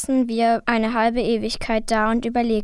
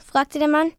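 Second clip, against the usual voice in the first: usual speaking voice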